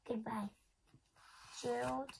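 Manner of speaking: soft breathy farewell; whispered goodbye. Acted. Gerald saying goodbye to Piggy, whispered.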